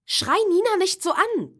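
high, loud reprimand; scolding. Acted. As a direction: tell your brother off sharply. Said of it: "tadelte Julia ihren Bruder".

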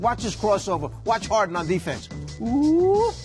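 normal talking voice

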